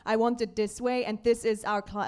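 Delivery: normal talking voice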